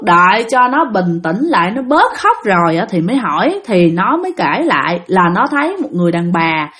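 ordinary delivery, nothing marked

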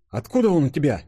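high, astonished question; amazed. A narrator's reading. Денис seeing the time device in her hand, amazed high question. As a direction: ask in amazement. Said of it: "изумился Денис".

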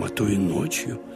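ordinary talk

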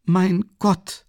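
hoher, etwas lauterer Ausruf auf Mein. A narrator's reading. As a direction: die Mutter ruft erregt auf. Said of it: "rief Pulcheria Alexandrowna aus". (Mutter ruft vor Aufregung hoch aus)

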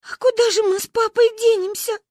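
high, loud tearful wail; crying. A narrator's reading. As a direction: wail in despair. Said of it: "зашлась в плаче Маня". (в плаче)

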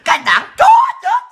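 high, loud, slow furious scream; shrieking with scornful laughter; screaming insult. Real, not acted. Escalating insults at party.

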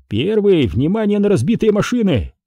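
high, urgent radio alert; radio call. A narrator's reading. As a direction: alert the commander over the radio. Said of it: "прошипел динамик". (тревожный вызов по рации)

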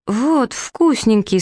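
high, slow, coaxing offer; sweetly offering. A narrator's reading. Prince hands the wolf his first pie, sweetly offering. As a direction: hold out the treat in a naive high voice.

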